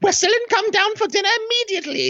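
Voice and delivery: put-on very high, fast Victorian parent summoning a child; Victorian parent calling. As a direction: call the child to dinner in a high put-on period voice. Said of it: "sounds like a Victorian child's name".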